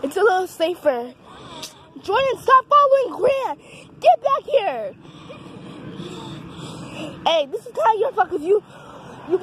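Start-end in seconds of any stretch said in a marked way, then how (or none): 2.0-4.9 higher, louder call to the dog. Real, not acted. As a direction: call him back across the path. Calling the dog back.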